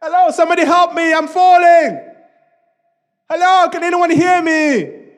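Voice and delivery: high, loud cry from a cliff; stretched, high-pitched yell for rescue; screaming for help. Acted. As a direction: call out desperately into the distance. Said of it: "Jack was screaming".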